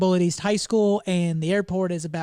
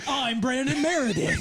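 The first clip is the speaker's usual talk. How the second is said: slow, laughing mock promo intro; wrestling promo; 6 semitones higher than usual. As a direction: ham it up through a laugh. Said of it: "Cutting a promo".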